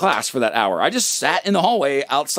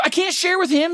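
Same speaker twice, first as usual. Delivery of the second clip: much higher, bratty; whiny mock protest; 14 semitones higher than usual. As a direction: squeak it out like a sulky teen. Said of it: "I go".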